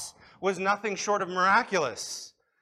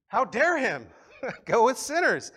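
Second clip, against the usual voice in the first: scoffing Pharisee voice, higher and louder, with a laugh; mocking Pharisees; 6 semitones higher, 5 dB louder than usual. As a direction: sneer it out like an outraged Pharisee.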